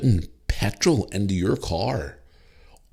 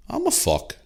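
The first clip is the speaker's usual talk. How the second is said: deadpan reply. Retold or imitated. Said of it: "And I went".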